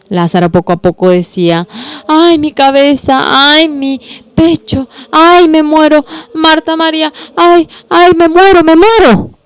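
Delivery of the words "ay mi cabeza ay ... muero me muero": higher, rising groaning of a dying man; dying moan. Acted. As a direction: moan each ay like life slipping away. Dying Lázaro moaning. (Lázaro moribundo se queja a sus hermanas, gemidos agudos)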